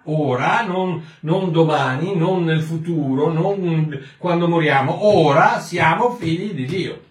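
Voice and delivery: fervent preaching, stressing "ora" and "non domani"; rising preaching. Acted. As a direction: build it up to "ora siamo figli di Dio", hitting "ora" hard. Preacher builds to key point.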